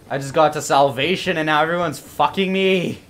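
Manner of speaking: loud, aggrieved outburst; exasperated complaint. Real, not acted. Gamer attacked right after salvation, exasperated. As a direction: protest loudly at the unfairness.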